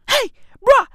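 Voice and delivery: high, loud incredulous rant; exasperated outburst. Pretend. Vlogger mocking Tottenham as unworthy, high exasperated yelling. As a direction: cry out in comic exasperation.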